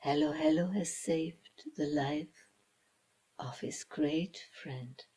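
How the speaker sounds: ordinary delivery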